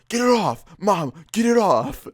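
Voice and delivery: high, loud, desperate yelling for mom; panicked yell. Retold or imitated. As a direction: yell it like you're begging mom to save you. Friend begs mom to remove it.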